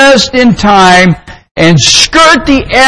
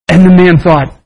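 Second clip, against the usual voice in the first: ordinary talk, nothing marked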